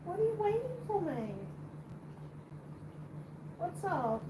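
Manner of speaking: high, soft coaxing voice for a cat; pet talk. Real, not acted. Asking her cat what it needs, high soft pet talk. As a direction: coo questions at a pet.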